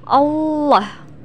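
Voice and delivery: Allah with a thick, dark L; thick lam. Shown as a demonstration. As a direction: say Allah with the L heavy, tongue pressed up to the palate. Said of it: "huruf lam harus terdengar menebal". (Allah dengan lam tebal)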